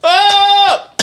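loud shocked cry, high; jolted yell from the smelling salts; sharp startled yell. Real, not acted. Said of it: "that was strong".

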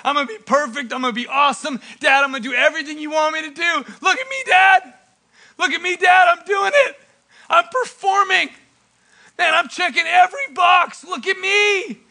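eager son begging for dad's attention; show-off son. Acted. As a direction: rattle off boasts, then cry for notice. Dutiful brother begging dad's attention.

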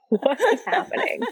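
high-pitched mock bewilderment, laughing; husband's bewildered reaction. Retelling her husband's reaction to her wailing, mock bewildered. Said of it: "he was just like".

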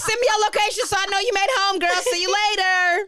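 high, loud mock send-off; acted-out goodbye. Sending a girlfriend off at four in the morning, high and loud.

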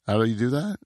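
ordinary talk

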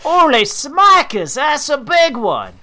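high, loud, slow mock Australian; Australian accent. Co-host imitates the angler's Australian exclamation, high and drawn out. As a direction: belt it like a cartoon Aussie angler. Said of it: "She's Australian".

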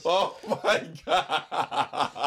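drawn-out laughing oh my God. Real, not acted. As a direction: laugh through the words, slow and high. Laughing at friend's voice crack.

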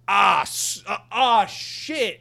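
sudden loud anguished cry, rising high; pained outburst. Retold or imitated. Stuck in traffic, remembering the game's ending, pained loud cry. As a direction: blurt it out like the ending just hit you. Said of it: "I'm like".

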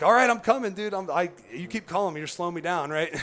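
hollering back to the guide, exasperated; calling back. Retold or imitated. Calling up the mountain to his impatient guide, mock shouting.

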